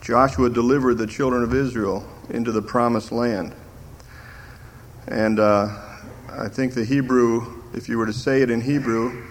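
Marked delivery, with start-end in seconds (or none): none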